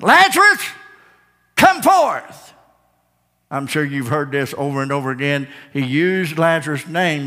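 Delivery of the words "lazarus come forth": high, booming summons to the dead; commanding call. Acted. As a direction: call him out of the tomb at full voice. Jesus calls the dead Lazarus out.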